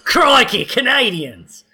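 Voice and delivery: high, hammed-up Australian accent; Wolverine's accent. Game master botching British Henry Cavill, exaggerated Australian accent. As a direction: exclaim it in a mock Australian accent. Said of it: "That's Wolverine's, right?".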